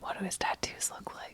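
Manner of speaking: hushed whispered question. Real, not acted. Player leans in to ask the GM, whispering. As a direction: ask it under your breath.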